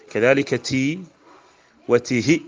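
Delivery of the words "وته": وته said "wa-tihi" with a short final "hi"; short vowel. Shown as a demonstration. Teacher listing feminine demonstratives, short-vowelled وته. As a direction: keep the end of وته short. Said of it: "بقصر التاء".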